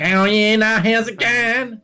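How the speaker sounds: high, slow, nasal Australian accent. Shown as a demonstration. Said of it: "It's just very nasal".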